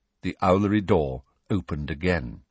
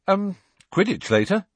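high, louder and cheery; happy; 12 semitones higher, 4 dB louder than usual. Acted. Harry answering Ron, happy and bright. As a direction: say it with glee. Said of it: "said Harry happily".

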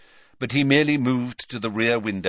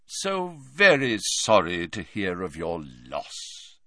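slow, deep, solemn Slughorn; mournful dignity, drawn out; deep grave voice; slower than usual. Acted. Slughorn offers Hagrid condolences, slow and solemn. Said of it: "in a deep, grave voice".

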